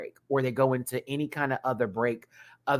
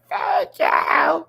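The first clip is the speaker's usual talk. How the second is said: high, loud hype shout; excited thanks; 7 dB louder than usual. Real, not acted. Thanking Jessica for the super chat, high excited shout.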